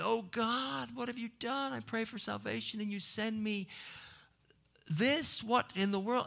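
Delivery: a small bird complaining to God; lightly voiced birdie, affronted; dismayed birdie.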